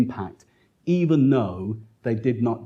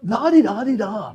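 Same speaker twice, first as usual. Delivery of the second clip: high sing-song la-di-da mocking, much higher; mocking heckler; 10 semitones higher than usual. Prisoner mocks the lecturer's talk in a high singsong. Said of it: "And whenever I speak, say".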